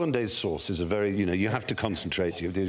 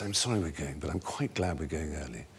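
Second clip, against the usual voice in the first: confiding; 7 semitones lower, 5 dB quieter than usual. Retold or imitated. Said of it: "and she says".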